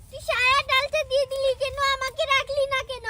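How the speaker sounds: put-on loud childish accusation; angry complaint. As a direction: demand to know why they took it all. Child fights over the dal.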